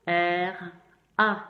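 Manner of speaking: R then A spelled out; spelling letters. Shown as a demonstration. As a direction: call out R, then A. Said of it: "comment on l'écrit?".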